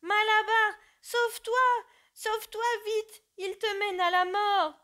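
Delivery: high, loud desperate cry; voice of catastrophe. Acted. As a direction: scream his name in despair. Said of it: "d'une voix de catastrophe".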